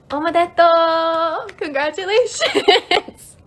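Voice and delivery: loud, high, drawn-out congratulations; celebratory cheer. Pretend. Streamer congratulating viewers on their introduction, celebratory cheer. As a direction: stretch each word out in a playful high cheer. Cheering learners for finishing the lesson.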